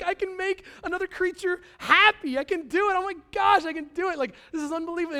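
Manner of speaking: high, loud amazed outburst; elated dad. Retold or imitated. Dad thrilled his son is happy, elated. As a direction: marvel excitedly at your own power. Acts out dad's elation at play.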